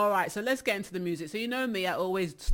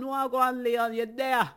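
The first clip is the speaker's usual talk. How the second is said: louder, higher shout-out to a viewer; calling out a greeting; 5 semitones higher, 6 dB louder than usual. Real, not acted. DJ shouting a greeting to a viewer on TikTok Live, loud and up. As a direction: call his name across the room, up and loud.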